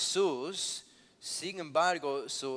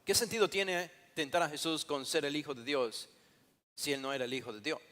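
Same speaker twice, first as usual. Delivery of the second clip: ordinary talk, nothing marked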